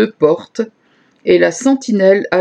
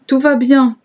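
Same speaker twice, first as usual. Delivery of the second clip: raised, higher call of good news; a lightly voiced shout, higher pitched; 6 semitones higher than usual. A narrator's reading. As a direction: call it out to the king from afar. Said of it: "Alors Achimaatz cria".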